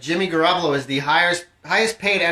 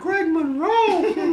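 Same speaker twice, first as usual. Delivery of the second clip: high-pitched coach; 13 semitones higher, much slower than usual. Acted. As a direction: bark it like a tiny squeaky coach. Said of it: "I'll be the high-pitched coach".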